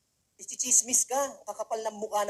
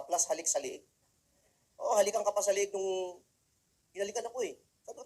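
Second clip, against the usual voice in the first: ordinary delivery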